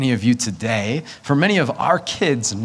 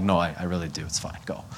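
quiet reassurance; 6 semitones lower, 9 dB quieter, faster than usual. Retold or imitated. Quietly sending wife away.

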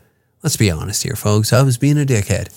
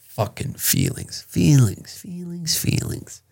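whispering the words in disgust; whispered disgust; 5 semitones higher, 9 dB quieter, slower than usual. Acted. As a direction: hiss it under your breath. Muttering disgust after rant.

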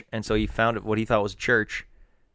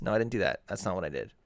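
normal talking voice

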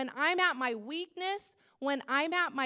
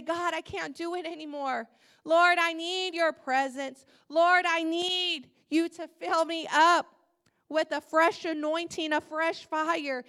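louder pleading cry to the Lord; fervent prayer; 4 semitones higher, 8 dB louder than usual. Pretend. Believer laying it at God's feet, loud fervent plea. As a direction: beg God loudly to fill you.

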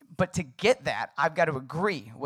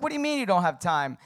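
retold impatient challenge, higher pitched; short and cutting; 4 semitones higher, 4 dB louder than usual. Husband challenging his wife over time, short and cutting. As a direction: cut back at the objection, a little raised. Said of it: "being really short and cutting".

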